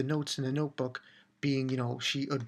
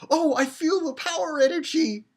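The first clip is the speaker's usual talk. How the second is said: high, loud, hammed-up skit acting; mock anime heroine; 12 semitones higher, 5 dB louder than usual. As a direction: mimic an over-the-top anime performer. Mimics girl acting out skit.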